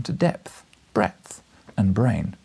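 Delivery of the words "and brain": ordinary delivery, nothing marked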